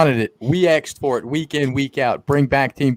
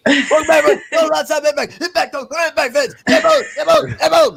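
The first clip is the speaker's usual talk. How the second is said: manic, very high-pitched mock co-host voice; hammed-up squeaky impersonation, loud catchphrases; Trent; 13 semitones higher, 4 dB louder than usual. Podcaster mocking his co-host to his face, frantic high impersonation. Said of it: "to pretend to be Trent".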